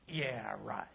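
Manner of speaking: breathy, dismissive "yeah, right" under the breath; scoffing whisper. Retold or imitated. Pastor mimics a doubter brushing off a claim, scoffing whisper. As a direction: whisper it like you don't buy a word.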